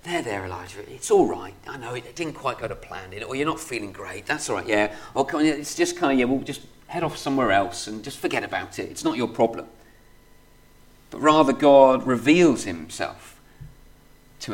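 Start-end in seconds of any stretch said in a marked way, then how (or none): 0.0-9.7 soft, soothing there-there voice, softer; mock coddling. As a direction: pat him on the head, overly soothing. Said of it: "it's not a kind of a".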